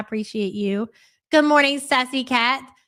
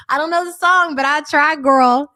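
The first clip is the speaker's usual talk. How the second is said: loud playful retort; 3 semitones higher, 7 dB louder than usual. Real, not acted. Loud reply to a viewer's song.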